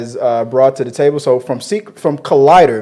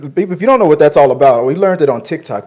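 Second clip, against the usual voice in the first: ordinary talk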